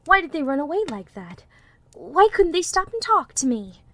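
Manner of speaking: hurt bewilderment. A narrator's reading. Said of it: "he thought".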